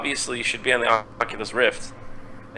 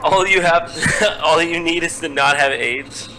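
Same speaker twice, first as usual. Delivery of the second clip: laughing repeat; 6 semitones higher, 5 dB louder than usual. Retold or imitated. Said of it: "repeats the quoted leader".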